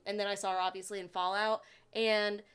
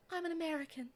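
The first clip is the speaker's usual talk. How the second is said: soft, higher, delicate voice; dainty, hushed imitation; Emma Watson; 6 semitones higher, 7 dB quieter than usual. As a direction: say it gently and quietly, pitched up. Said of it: "a very softness to her voice".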